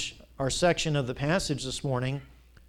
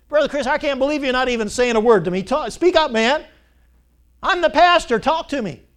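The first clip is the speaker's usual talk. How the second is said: much higher and louder, faster, demanding an answer; mock indignant shout; 10 semitones higher, 11 dB louder than usual. Pastor mock shouting at a corpse to talk to him, loud. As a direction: demand the dead man speak up. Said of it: "I said".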